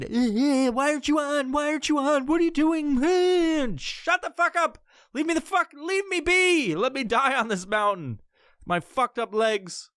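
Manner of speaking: high put-on whine; mock whiny pestering. Imitating friends nagging him on the mountain, in a high whiny voice. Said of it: "So they all wanna fucking".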